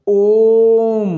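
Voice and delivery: loud "ओम" with the O held very long; chant-like, drawn-out "ओम"; prolonged pluta vowel. Shown as a demonstration. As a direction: sustain "ओम" on one steady note. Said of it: "ये शब्द प्लुत करना पड़ेगा".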